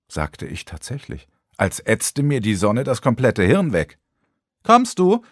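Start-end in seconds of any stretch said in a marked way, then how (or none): 4.6-5.2 high, loud crowing question; crowing call. A narrator's reading. Vanessa crows at Lukas to come along. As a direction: crow it out like an impatient girl. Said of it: "krähte Vanessa".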